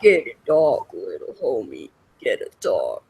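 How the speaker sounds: very low put-on rumble; deepest voice. Shown as a demonstration. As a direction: drop as deep as you can. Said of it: "Is that the deepest that you can talk".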